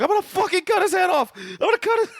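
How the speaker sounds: fast, very high-pitched rant; hammed-up high rushed rage; mock frantic threat. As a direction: squeal the threat in pretend fury. Acting out a threat to behead.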